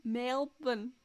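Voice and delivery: put-on Aussie place name; attempted Australian accent. Shown as a demonstration. Host answers co-host's challenge in a clumsy Australian accent. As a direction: have a shaky go at an Australian accent. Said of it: "How do you say where the competition was held?".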